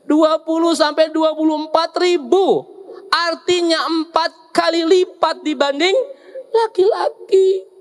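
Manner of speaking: high, drawn-out emphatic proclamation; emphatic reveal. Real, not acted. Preacher revealing wives' word count to the husbands, emphatic. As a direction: stretch each number out high and slow.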